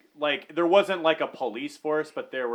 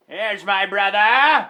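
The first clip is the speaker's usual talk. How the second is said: louder, slow, hammed-up call; mock call; 5 semitones higher, 8 dB louder, slower than usual. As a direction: drag it out like a joke shout. Colonel searching for brother.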